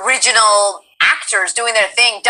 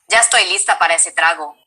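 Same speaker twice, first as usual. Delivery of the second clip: lower, quick demo line; less nasal; 5 semitones lower, faster than usual. Shown as a demonstration. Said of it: "it's a little less nasally".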